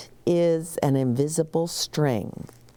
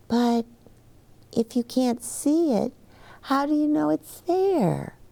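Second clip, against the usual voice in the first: lightly voiced little girl; 9 semitones higher than usual. Child Liza voiced.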